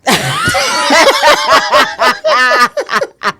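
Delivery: high shrieking laugh; loud laugh. Real, not acted. Laughing hard at the crying bit, loud high laugh.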